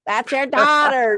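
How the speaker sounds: hammed-up shout of a grumpy old woman; imitating a scream. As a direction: call it out across the room like a cranky old lady. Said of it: "she would scream out".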